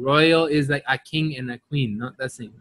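ordinary delivery

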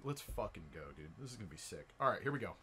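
ordinary delivery

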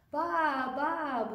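loud, high storybook baa; sheep bleat. A narrator's reading. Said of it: "bleated the sheep".

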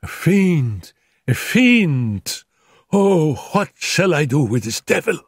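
delirious terror. Acted. Feverish wife raving about the devil, anguished. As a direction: rave in fevered dread.

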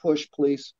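push, please dropped to a soft coax. Shown as a demonstration. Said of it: "that sort of straight punch".

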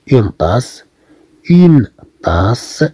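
une tasse said clearly with s; French pronunciation demo. Shown as a demonstration. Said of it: "كما رأينا هنا". (نطق une tasse بوضوح)

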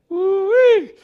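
high, loud joyful woo-wee; ecstatic whoop. Real, not acted. Host whooping again over the word, high and loud. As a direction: burst out with joy. Overwhelmed by the sermon.

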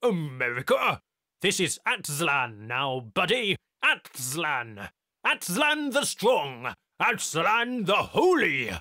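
loud mocking proclamation; mock-proclaim with a grin; friendly sarcasm. A narrator's reading. Technician mocking Tyler about America, sarcastic. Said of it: "Friendly sarcasm edged his reply".

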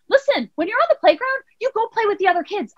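high-pitched mock scolding; imitated parental lecture; lecturing mother. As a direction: lay down the law to a small child. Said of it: "I was, like, lecturing her".

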